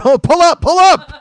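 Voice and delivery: high, loud cockpit alarm shouting; mock panic. Comic as pilot of a nosediving plane, mock panicked shout. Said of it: "the plane went into a nosedive".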